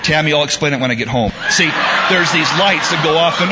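hurried, laughing back at the heckle; laughing reply. Real, not acted. Preacher answering wife, laughing.

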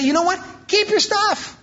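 high, exasperated divine rebuke; God. Acted. Preacher voicing God to His people, exasperated. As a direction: push it high, fed up with the offerings. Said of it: "how often he would say".

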